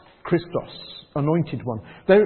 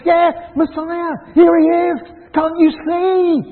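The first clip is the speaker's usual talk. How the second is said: loud, high, slow exclamation; insisting; 12 semitones higher, 9 dB louder, slower than usual. Retold or imitated. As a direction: insist loudly, high and drawn out. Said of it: "And Bartimaeus says".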